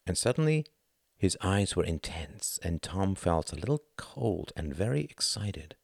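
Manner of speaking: ordinary delivery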